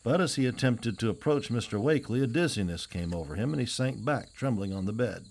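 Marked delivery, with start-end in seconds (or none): none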